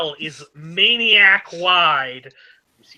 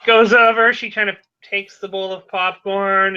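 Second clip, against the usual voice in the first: normal talking voice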